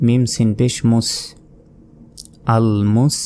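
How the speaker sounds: normal talking voice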